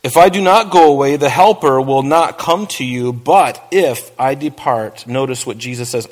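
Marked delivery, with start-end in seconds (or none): 0.0-5.1 measured, solemn Bible reading; scripture reading. Acted. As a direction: read the verses with weight, slowing on key lines. Reads scripture aloud in sermon.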